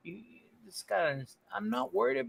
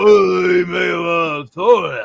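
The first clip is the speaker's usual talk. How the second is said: loud, gruff macho shout; macho Stallone voice; 9 dB louder than usual. Retold or imitated. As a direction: bellow it like a tough guy. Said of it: "I'm totally impersonating you".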